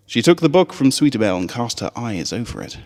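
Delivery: ordinary delivery, nothing marked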